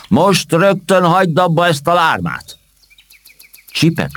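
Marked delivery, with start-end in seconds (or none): 0.1-2.6 shouted command. Acted. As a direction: call out sharply from the bushes. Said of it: "rájuk kiáltott".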